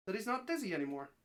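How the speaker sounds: ordinary delivery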